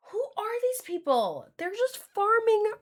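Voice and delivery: high, incredulous sarcasm; mock outrage pitched high; mock disbelief. Mocking the AI fans.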